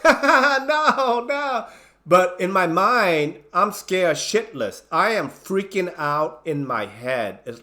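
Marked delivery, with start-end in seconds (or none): 0.0-1.7 high, giggly "no, no" through laughter; laughing protest. Retold or imitated. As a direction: laugh while pushing them away. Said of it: "I'm, I'm laughing".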